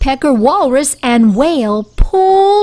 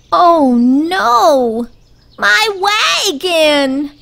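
mock wail, higher and drawn out; a worm upset over his broken wagon; 6 semitones higher, much slower than usual. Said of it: "Worm wailed".

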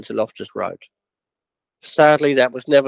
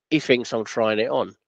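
normal talking voice

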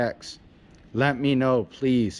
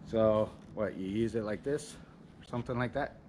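much softer, half to himself, puzzling it out; muttering to himself; 3 semitones lower, 11 dB quieter than usual. Real, not acted. Testing the new oar, he mutters to himself quietly. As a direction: mumble under your breath while testing the oar.

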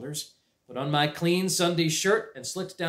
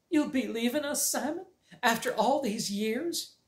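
tearful aunt; 10 semitones higher than usual. Acted. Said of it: "dabbed her apron at a corner of her eye".

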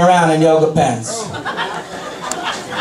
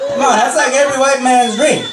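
ordinary delivery

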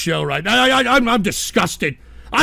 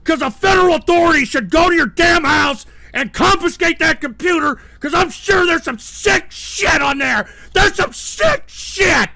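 real angry outburst, voice raised; angry rant; 6 semitones higher than usual. Real, not acted. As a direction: rant in disgust, leaning on the swearing. Angry rant at a chat member.

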